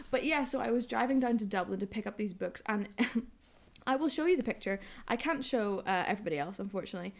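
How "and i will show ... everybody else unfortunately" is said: ordinary talk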